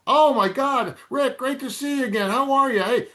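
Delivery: put-on suddenly beaming at an old friend; gushing high-pitched welcome; lit up. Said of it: "Well, he lit up".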